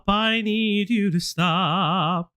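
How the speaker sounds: each word slowed right down; slow emphatic plea. Real, not acted. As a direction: drag it out so they finally listen. Repeats it much slower.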